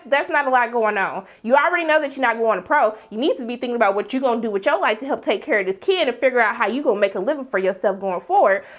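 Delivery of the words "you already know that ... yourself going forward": ordinary talk, nothing marked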